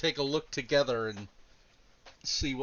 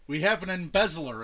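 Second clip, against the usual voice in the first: slightly louder, mildly mimicked attitude; copied attitude; 3 semitones higher, 6 dB louder than usual. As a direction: lightly imitate her attitude, a bit louder. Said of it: "copy her attitude and say".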